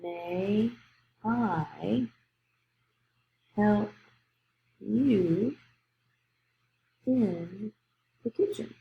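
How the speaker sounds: each word alone with long pauses; spelling-test dictation, softly; slow dictation. Shown as a demonstration. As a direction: dictate it one word at a time. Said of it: "you would say".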